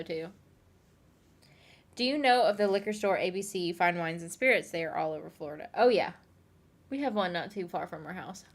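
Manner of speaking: usual speaking voice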